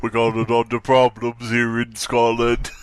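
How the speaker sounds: mock Scottish accent, hammed up. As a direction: put on a jokey Scottish accent. Mock motto of Dundee, Scotland.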